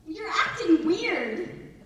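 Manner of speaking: put-on high, loud, slow child's complaint; puzzled son. Son tells mom she's strange, imitated bewildered child. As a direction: imitate a kid weirded out by mom. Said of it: "He's like".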